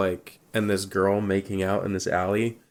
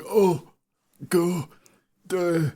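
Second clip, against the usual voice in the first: high, halting mock pleas; hammed-up high moans for help; imitating a begging man; 9 semitones higher, slower than usual. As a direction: imitate someone helplessly begging, word by word. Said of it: "begging for help".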